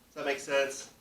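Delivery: ordinary delivery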